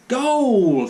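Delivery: loud, high shout of goal; dad roaring at the football; cheering goal. Acted. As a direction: yell it at the telly like a fan. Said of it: "said Daddy".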